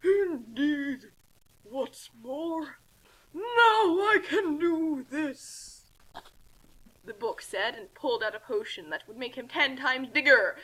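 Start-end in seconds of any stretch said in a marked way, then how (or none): 0.0-5.9 slow, put-on villain voice; villain gloat. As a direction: savor each word like a villain.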